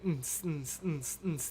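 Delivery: half-hearted club beat noises; soft, rhythmic "ns, ns" beatboxing; mouthed party beat. Pretend. Streamer mocking up a party, mouthed dance beat.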